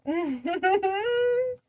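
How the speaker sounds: high giggle; laughing. Real, not acted. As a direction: laugh at yourself. Laughing at herself.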